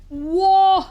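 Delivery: sudden loud high gasp of surprise; startled whoa. Real, not acted. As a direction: caught off guard, yelp it.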